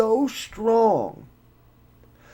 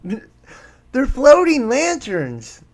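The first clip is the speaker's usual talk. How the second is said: slow, higher and louder sarcastic "They're floating lanterns."; mocking skeptic; 7 semitones higher, 6 dB louder, much slower than usual. Host mocking the debunkers who dismiss the fireballs, sarcastic. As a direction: drag it out like a smug debunker. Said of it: "this is why people will believe any damn thing".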